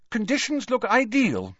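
put-on high, upbeat girl's voice; eager, high-pitched report. A narrator's reading. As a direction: announce good news, pitched well up. Ginny sharing good news.

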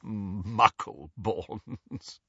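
very slow, drawn-out scorn; sniggering contempt. A narrator's reading. Portrait mocking Hermione's question, sniggering. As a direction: stretch the word out with a scornful snigger. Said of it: "and sniggered".

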